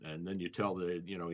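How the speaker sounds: usual speaking voice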